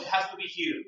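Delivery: usual speaking voice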